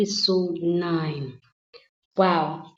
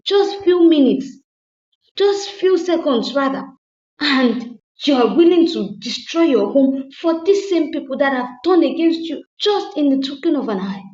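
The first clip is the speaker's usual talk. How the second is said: stern husband; 5 semitones higher, 5 dB louder than usual. Retold or imitated. Husband lecturing wife.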